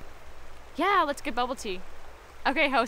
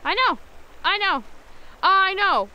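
loud, higher gossip agreement; emphatic agreeing; 5 semitones higher, 7 dB louder, slower than usual. Acted. Chatty girl agrees loudly with her friend on the phone. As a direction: pile on the agreement, louder each time.

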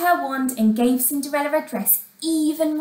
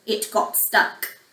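ordinary delivery, nothing marked

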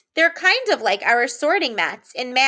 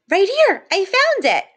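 higher and louder, hammed-up delight; mock excitement; 8 semitones higher, 3 dB louder than usual. Teacher shows children her pretend delight at finding a digraph. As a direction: sound thrilled to have found it.

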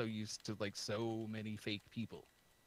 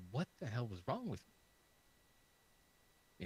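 slow, stunned self-disbelief; exasperated disbelief; much slower than usual. Retold or imitated. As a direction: drag it out in disbelief. Retold self now, exasperated.

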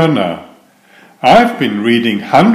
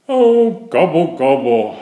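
howling; 3 semitones higher, 3 dB quieter than usual. Acted. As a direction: wail it out in despair. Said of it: "howled Turkey".

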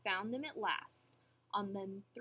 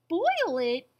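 loud, high cry of disbelief; shocked gasp; 5 semitones higher, 11 dB louder than usual. A narrator's reading. Horton hearing of the boiling, shocked gasp. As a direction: burst out aghast. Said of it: "gasped Horton".

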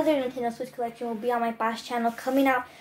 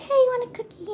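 much higher, sweet cuddly monster voice; friendly cute voice; 11 semitones higher, faster than usual. Retold or imitated. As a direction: coo it like a cuddly creature offering a treat. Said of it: "so friendly".

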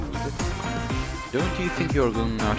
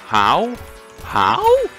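incredulous; 13 semitones higher, 5 dB louder than usual. Real, not acted. Baffled by enemy surviving.

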